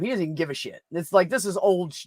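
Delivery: usual speaking voice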